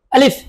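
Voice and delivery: আলিফ said firmly, a strong clear a; a firm demo of আলিফ; firm alif. Shown as a demonstration. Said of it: "শক্ত করে আদায় করতে হবে". (আলিফ শক্ত করে উচ্চারণ)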